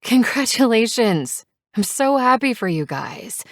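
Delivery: excited congratulations, a bit teary; happy tearful gush. A narrator's reading. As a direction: overflow with joy through tears. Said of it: "I'm a crying mess and I start clapping".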